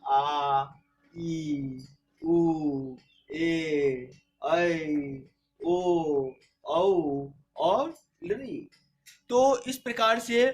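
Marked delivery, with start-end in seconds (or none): 0.0-8.6 आ, ई, उ each held long and clear; listing the दीर्घ स्वर for students; long vowels recited. Shown as a demonstration. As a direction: draw out every long vowel. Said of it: "वहीं दीर्घ स्वर में हो जाएगा".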